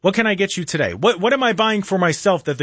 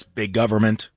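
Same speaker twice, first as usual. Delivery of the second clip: lower, flat robot voice droning the phrase; robotic monotone; 7 semitones lower than usual. Pretend. Host mimics a robot repeating what he hears. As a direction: drone it like a machine. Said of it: "monotone, robotic sounding voice".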